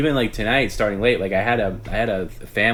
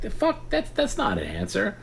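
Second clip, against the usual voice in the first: exasperated. Real, not acted. Exasperated at non-answer.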